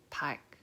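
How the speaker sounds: park said with the L swallowed by a G stop; park, tongue reaching for L but shut off at the back; whispered park, L cut short by the G; pronounced park. Shown as a demonstration. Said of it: "pronounced as park".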